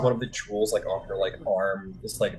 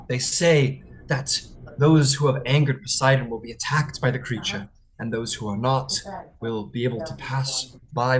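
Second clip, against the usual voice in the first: raised, louder, dread-filled telling; fearful tale; 4 semitones higher, 5 dB louder than usual. Acted. Freed man warning of the monster in dread. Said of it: "looks around and goes".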